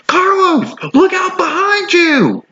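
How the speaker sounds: Astrid yelling to warn Carlos; exclaimed warning. A narrator's reading. Astrid warns Carlos of wolf, high shrill cry. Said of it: "exclaimed Astrid".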